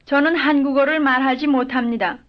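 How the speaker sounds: normal talking voice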